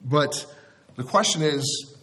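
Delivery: normal talking voice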